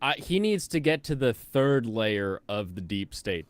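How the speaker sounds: ordinary talk, nothing marked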